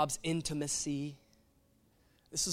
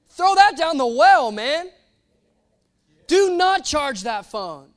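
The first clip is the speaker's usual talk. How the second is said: preaching at full force; 9 semitones higher, 13 dB louder than usual. Retold or imitated. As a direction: hammer each phrase home. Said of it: "And I'm like".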